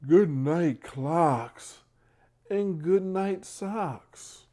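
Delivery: drawing out each rhyming line; bedtime story reading. Acted. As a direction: read it out like a picture book for little ones. Reads bedtime story aloud.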